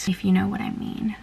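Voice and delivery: unvoiced whisper, cheeky; whispered aside. Real, not acted. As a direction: whisper it as a secret. Cheeky aside about pre-workout.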